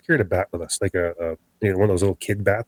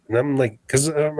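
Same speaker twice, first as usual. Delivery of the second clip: plain reading voice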